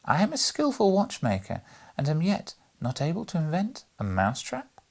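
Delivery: a high, lightly voiced mysterious aside; hint of a secretive character voice; mysterious undertone. A narrator's reading. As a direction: voice the old watchmaker's puzzled riddle. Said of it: "mysteriously and said in an undertone".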